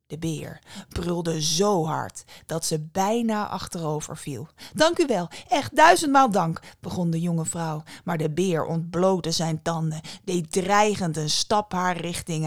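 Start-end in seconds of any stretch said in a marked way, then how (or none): 4.7-6.6 high, loud, gushing gratitude; effusive thanks. A narrator's reading. Young woman thanking the bear for the hair, effusive thanks. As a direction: thank profusely with raised voice.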